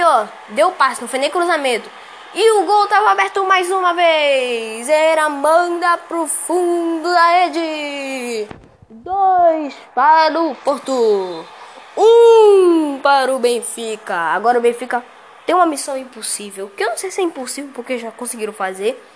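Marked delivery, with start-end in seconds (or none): 2.3-14.1 excited goal commentary. Real, not acted.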